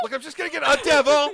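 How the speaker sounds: defensive outburst. Real, not acted.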